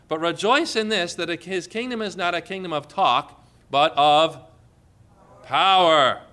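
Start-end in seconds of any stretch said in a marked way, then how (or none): 3.7-6.2 preaching shout. Real, not acted. Preacher to the congregation, loud on the word power.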